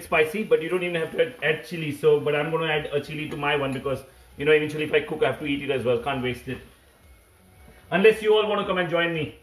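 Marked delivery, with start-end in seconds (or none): none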